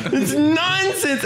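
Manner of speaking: high, laughing shout of no; laughing protest. Real, not acted. Refusing co-host's claim, high laughing protest. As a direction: burst out laughing in disbelief. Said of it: "laughing disagreement with co-host".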